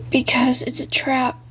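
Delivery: whispered ghost warning; ghostly whisper. Acted. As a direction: hush it like a dead boy on the line. Ghost voice on the phone.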